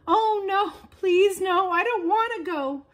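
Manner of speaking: high, pleading and scared; frightened little seed. A narrator's reading. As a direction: beg not to be taken away. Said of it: "thought Little Seed".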